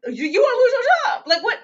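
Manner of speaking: laugh-cry at the absurdity; incredulous laugh. Real, not acted. Disbelief laughing at predator.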